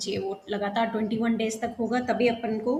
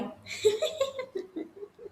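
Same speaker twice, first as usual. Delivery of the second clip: high, breathy burst of laughter; bright laugh; 10 semitones higher, 5 dB quieter than usual. Real, not acted. Teacher laughs at the boys.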